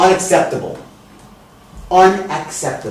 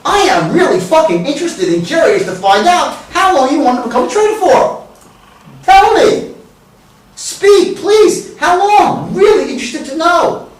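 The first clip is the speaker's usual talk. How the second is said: much higher, pleading and eager; over-the-top interest; 9 semitones higher than usual. Shown as a demonstration. Coach exaggerating curiosity for trainees, high and pushy. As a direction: beg them to tell you. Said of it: "What did the tonality imply there?".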